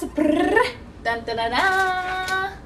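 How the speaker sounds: slow sung dun dun fanfare; playful vocal fanfare; mock drumroll. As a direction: build suspense like a drumroll. Drumroll announcing the title.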